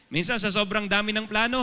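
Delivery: ordinary delivery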